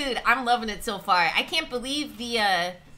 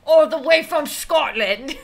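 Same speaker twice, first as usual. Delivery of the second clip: louder, badly faked Scottish accent; mock Scottish accent; 3 semitones higher, 5 dB louder than usual. Streamer announcing the chatter's home, loud mock Scottish accent. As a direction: belt out a hammy Scottish accent. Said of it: "I know my accent's really bad".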